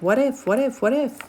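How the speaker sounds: high, fretting mock worry; anxious what-ifs. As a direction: spiral into worried what-ifs.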